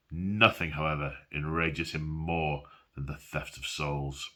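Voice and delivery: low, dramatic reading voice; story narration. Acted. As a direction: read it like a grim legend. Reads book vignette aloud.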